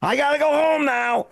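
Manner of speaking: confused mother-in-law. Retold or imitated. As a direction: imitate a mother-in-law announcing her departure. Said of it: "my mother-in-law get up from her chair and go".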